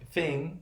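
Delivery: FING cut off on the ng nasal, before any g; first half of finger, FING, with no hard g; velarized ending. Shown as a demonstration. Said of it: "we still have the velarization".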